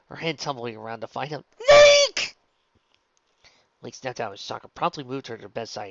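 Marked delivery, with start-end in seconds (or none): 1.6-2.4 desperate scream. A narrator's reading. Calling out in pain.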